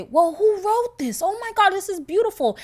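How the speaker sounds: high, loud gushing admiration; gushing amazement. Retold or imitated. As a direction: play up being amazed and delighted. Acts out admiring reaction to movie.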